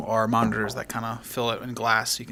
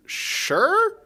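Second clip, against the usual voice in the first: incredulous; 4 dB louder than usual. Acted. GM doubtful at odd request.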